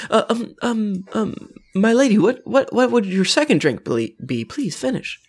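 high, stammering plea; nervous flustered voice. Acted. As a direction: stammer it out, eager to please. Nervous NPC begging a lady.

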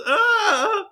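very high, loud frightened "Ah!"; terrified shriek. Acted. Intimidated girlfriend shrieks at the threat. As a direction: shriek in terror. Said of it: "She goes".

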